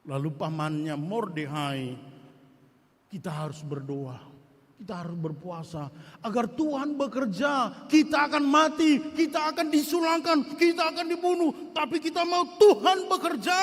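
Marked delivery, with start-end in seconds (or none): 3.1-13.6 urgent plea. Acted. Mordecai's urgent call to fast.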